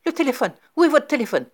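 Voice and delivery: high, loud frantic yell; frantic shout for a telephone. A narrator's reading. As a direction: demand the phone in a panic. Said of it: "il hurlait".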